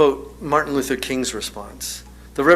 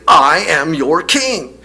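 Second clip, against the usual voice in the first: slow, much louder royal declaration; King Arthur insisting, slow and loud; forceful declaration; 2 semitones higher, 9 dB louder, slower than usual. Retold or imitated. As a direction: plant each word like a king asserting rank. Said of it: "very forcefully".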